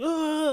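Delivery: high mock toddler cry of protest; dad imitating his son's protesting wail; mock child wail. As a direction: squeal like a kid about to throw a fit. Son about to melt down.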